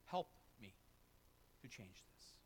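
soft, low prayer to the Lord; humble prayer. Pretend. Believer asks the Lord for help, in a soft low prayer. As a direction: pray it quietly, asking for help. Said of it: "if we'd say".

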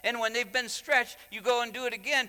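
high, urgent sermon delivery; impassioned preaching. Real, not acted. Preacher explains the parable of old skins, high-pitched and impassioned.

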